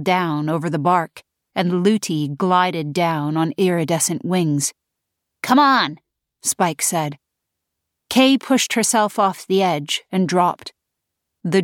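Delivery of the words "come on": urgent. Acted. Spike urging Kay.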